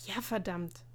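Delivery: usual speaking voice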